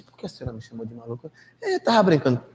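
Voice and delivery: soft, hushed confrontation; quietly reproachful exchange; speaking softly. Retold or imitated. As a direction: keep it quiet, just between the two of you. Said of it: "Eu tinha que falar baixinho".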